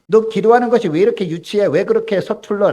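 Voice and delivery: high, loud pretend rebuke; mock scolding. Imagined God scolding a clumsy pray-er, loud mock rebuke. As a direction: scold a clumsy child who prays badly. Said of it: "라고 하면서 꾸짖지 않으신다는".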